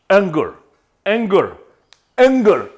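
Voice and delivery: "Anger" opening on a vowel between A and E. Shown as a demonstration. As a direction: start "Anger" halfway from A to E. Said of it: "entre la A y la E". ("Anger" con vocal entre A y E)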